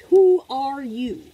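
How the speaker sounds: booming slow question to the viewer; emphatic preaching. Real, not acted. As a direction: hit each word like a sermon.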